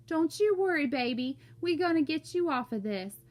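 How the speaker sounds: high, anxious Southern reassurance; shaky Southern voice. A narrator's reading. Mama wipes Byron's tears, shaky Southern reassurance. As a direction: soothe him in a shaky Southern accent. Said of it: "her voice was so shaky and Southern".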